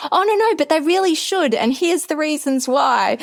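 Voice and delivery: high, earnest plea to be heard; eager pleading. Retold or imitated. Pleading with the professor to keep the topic, high and eager. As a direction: talk her round, too brightly.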